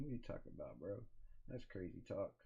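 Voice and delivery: ordinary talk, nothing marked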